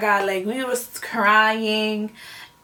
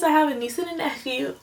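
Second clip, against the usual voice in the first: ordinary talk, nothing marked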